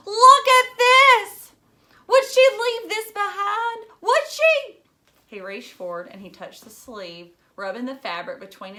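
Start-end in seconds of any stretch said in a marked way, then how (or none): 0.1-4.8 much higher and much louder, pleading and furious; desperate outburst. Acted. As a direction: shove the sweater in his face, voice cracking high. Phoebe waves mother's sweater at father.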